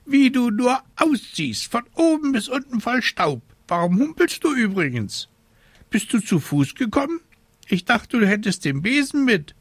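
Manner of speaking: high, quick squawky questioning; fussy raven. Acted. Raven inspecting the dusty witch, high fussy questioning. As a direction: pester like an agitated bird.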